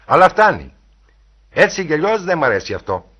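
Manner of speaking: loud, high-pitched protest; shouted objection. A narrator's reading. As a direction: call a firm halt to her game. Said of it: "φώναξε ο Χέιτον".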